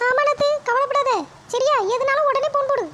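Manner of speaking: daughter-in-law voice. Acted. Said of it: "மருமகளே, போய் coffee கொண்டு வாமா".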